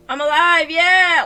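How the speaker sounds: loud, jubilant shout; triumphant cheer. Real, not acted. Player celebrating the character's revival, loud triumphant cheer. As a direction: cheer loudly at coming back to life.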